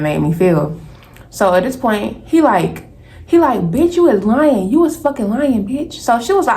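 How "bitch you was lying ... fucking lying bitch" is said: high-pitched retold angry outburst; angry accusation. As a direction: act out his indignant accusation, pitched up. Acted quarrel, man accusing her.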